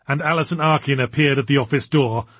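usual speaking voice

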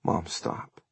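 soft, hushed plea; whispered. A narrator's reading. Son asking his praying mother to stop, whispered. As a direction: plead quietly. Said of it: "he whispered".

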